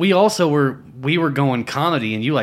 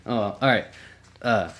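ordinary talk

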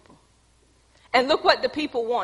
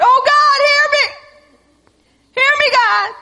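much higher and louder, a frantic hammed-up wail; mock desperate prayer; 13 semitones higher, 7 dB louder than usual. Preacher mocks churchgoers begging God: frantic high wail. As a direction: overplay a panicked believer begging God. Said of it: "How many of y'all did that with God?".

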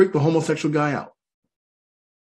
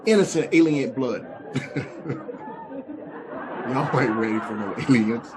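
ordinary talk, nothing marked

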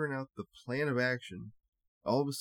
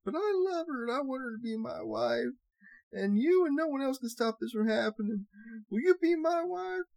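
high-pitched hammed-up wail; hammed-up tearful begging; mock crying; 11 semitones higher than usual. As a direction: plead in a fake, high sobbing voice. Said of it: "he started crying and said".

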